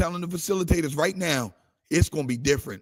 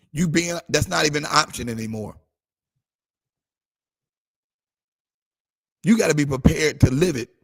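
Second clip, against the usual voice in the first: building sermon cadence; full-force pulpit preaching. Real, not acted. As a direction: preach it to the congregation. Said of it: "I'm preaching".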